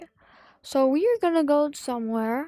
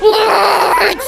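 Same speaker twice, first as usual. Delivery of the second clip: long, loud cry of disbelief; shocked shout; 4 semitones higher, 11 dB louder than usual. Real, not acted. Player told the safe spot isn't safe, shouting in shock. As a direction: yell it in utter disbelief.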